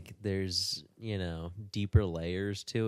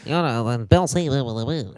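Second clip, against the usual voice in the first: loud, raised sneering mockery; mocking whiny voice; 4 semitones higher, 8 dB louder, faster than usual. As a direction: put on a mocking whine. Mocking Shapiro's debate opponent.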